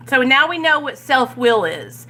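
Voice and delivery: normal talking voice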